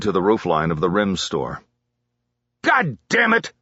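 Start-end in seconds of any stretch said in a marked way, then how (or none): 2.6-3.5 high angry curse; furious yell. A narrator's reading. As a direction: yell out in rage. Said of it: "the sergeant yelled".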